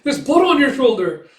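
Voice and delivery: high, loud alarmed cry; frightened cry. Retold or imitated. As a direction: blurt it out in alarm. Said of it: "both furious and, like, uh, frightened".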